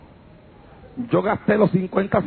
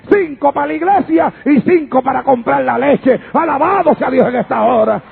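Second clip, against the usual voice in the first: higher, driving Pentecostal testimony; impassioned church testimony at full voice; fervent preaching; 4 semitones higher, 4 dB louder than usual. Real, not acted. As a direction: preach it out in forceful phrases, building to a shouted praise.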